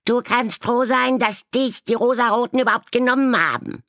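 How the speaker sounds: very high, loud, fast taunting; heated taunt. A narrator's reading. Raven taunting another in a quarrel, very high and loud. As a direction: rub in the insult.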